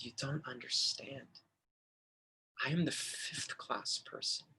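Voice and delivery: hushed, like holding back tears; emotional hush. Retold or imitated. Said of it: "He became very emotional".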